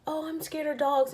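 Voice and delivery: a hint of a nervous little girl; scared little girl. Little girl balks at dogs by the driveway, mildly scared. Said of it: "she was like".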